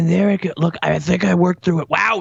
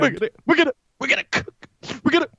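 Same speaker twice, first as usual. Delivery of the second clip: blubbering; 6 semitones higher, much faster than usual. Acted. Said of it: "tone it down a s".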